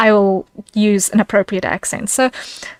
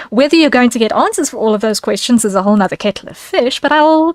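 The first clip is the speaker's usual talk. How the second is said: ordinary talk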